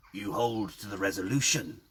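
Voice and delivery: a hint of an old man's croak. Old man at the well grips Phil's shoulder, lightly croaked. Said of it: "he croaked".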